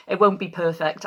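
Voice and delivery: ordinary talk, nothing marked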